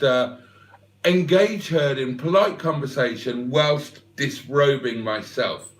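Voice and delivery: normal talking voice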